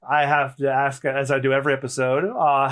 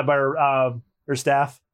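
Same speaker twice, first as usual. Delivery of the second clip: ordinary talk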